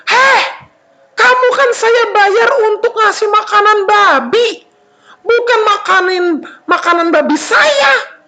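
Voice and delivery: high, hammed-up furious telling-off; angry scolding. Pig owner scolds worker for eating pig food, angrily. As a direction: lay into the worker indignantly. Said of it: "yang punya babi marah".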